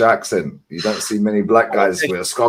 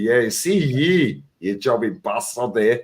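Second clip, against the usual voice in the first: Glaswegian Fergie impression; 4 dB quieter, slower than usual. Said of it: "you sound like fucking Prime Fergie".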